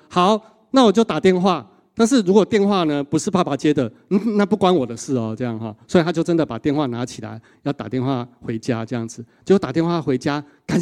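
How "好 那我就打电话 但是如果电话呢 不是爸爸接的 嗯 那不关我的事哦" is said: higher, half-cheeky bargain; bargaining with God. Retold or imitated. Brother bargaining with God about who answers, a bit cheeky. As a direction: strike a sly deal, hedging. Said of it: "他就跟神说".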